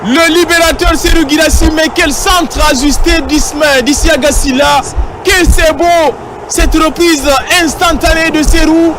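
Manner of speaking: goal call, high and shouting; excited sports commentary. Real, not acted. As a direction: yell the goal with joy. (commentaire de but exalté, crié)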